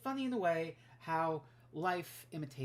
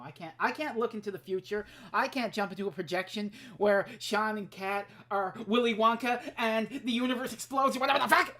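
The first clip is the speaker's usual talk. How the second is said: building exasperated rant, growing louder; heated rant that gathers volume; getting heated; 4 dB louder than usual. Real, not acted. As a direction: work yourself up as you go. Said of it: "I'm getting a little heated".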